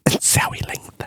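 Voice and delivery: whispered aside out of the scene; real whisper. Real, not acted. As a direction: drop to a whisper to break character.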